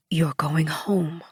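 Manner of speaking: leise, langsam geflüstert; soft slow whispered comfort. A narrator's reading.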